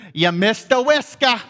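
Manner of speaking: put-on loud, blunt old-timer voice; older gentleman. Older stranger confronts the young man after service, imitated blunt old gentleman. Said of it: "this older gentleman".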